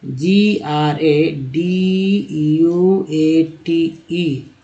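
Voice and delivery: letters spelled out slowly one by one; slow letter-by-letter spelling. Shown as a demonstration. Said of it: "एक word ले लेते हैं समझने के लिए".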